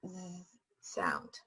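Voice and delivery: whispered hiss for z sound. Shown as a demonstration. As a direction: hold a long hissing s in z sound. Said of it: "that's the way to make a s or".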